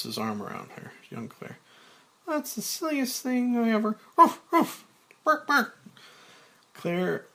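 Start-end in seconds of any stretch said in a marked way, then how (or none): 2.3-4.0 put-on high-pitched, animated young woman's voice; young Claire. Young Claire teasing over his shoulder, high playful voice. 4.2-5.8 high, loud dog barks; barking like a dog. Acted. Claire starts barking like a wild dog, high loud yaps. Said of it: "begins howling and barking like a wild dog".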